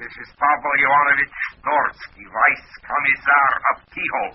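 high, hammed-up Russian official voice; comedy Russian accent. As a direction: announce yourself as a pompous Soviet commissar. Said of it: "What's the idea of the comedy accent?".

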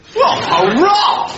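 high, startled whoop of joy; gleeful hurrah; delighted cheer. Acted. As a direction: cheer the good news heartily. Cheering the news of revolution.

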